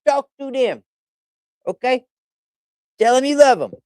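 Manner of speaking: high, sing-song pet talk; high cooing voice for a pet. Real, not acted. Coaxing the cat on stream.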